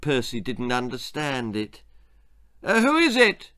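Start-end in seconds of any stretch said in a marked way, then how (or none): 2.6-3.5 high, loud call from upstairs; the father calls from the upstairs bathroom, high and loud; shouting. Acted. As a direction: call it down through the house. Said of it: "shouted Mister Crump Dump".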